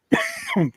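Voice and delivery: sharp dry cough. Real, not acted. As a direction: cough hard mid-sentence. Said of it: "pardon me".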